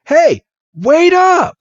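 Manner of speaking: high, drawn-out call; mock call after someone getting away. Red chasing after Bill, calling out high.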